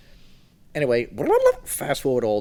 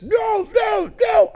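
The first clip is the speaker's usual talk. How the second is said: high, loud chant of "No, no, no!"; crowd shout; 9 dB louder, much slower than usual. Retold or imitated. Joining tall fellas in a bar, yelling high and loud. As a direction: yell along with a rowdy crowd.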